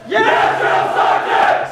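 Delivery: high, slow shouted reply; barked military recruit reply. Real, not acted. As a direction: bark it back in unison. Recruits answer drill sergeant.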